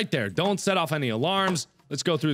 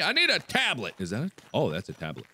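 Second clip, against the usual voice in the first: normal talking voice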